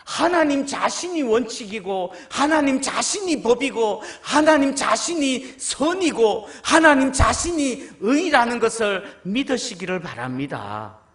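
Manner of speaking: loud emphatic repetition on 하나님; preaching. Real, not acted. Preacher exhorting the congregation, loud. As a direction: build the list with force.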